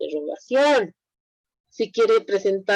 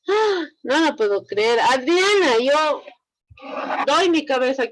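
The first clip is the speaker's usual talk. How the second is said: mock disbelief; 4 semitones higher than usual. Nobody has been to storytelling.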